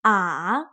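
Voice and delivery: "ah" dipping low then rising; third tone ah. Shown as a demonstration. Teacher demonstrates third tone, dipping. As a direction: dip "ah" down then up. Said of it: "goes down and then up again".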